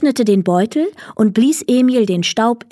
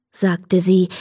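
ordinary delivery

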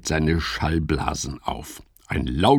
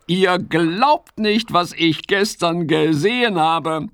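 higher, lively frog voice; Fips the frog; 10 semitones higher than usual. Acted. Fips tells Ole what he has heard, curious. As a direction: play a curious little frog.

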